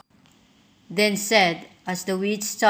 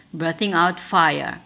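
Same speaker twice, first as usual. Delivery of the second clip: ordinary delivery, nothing marked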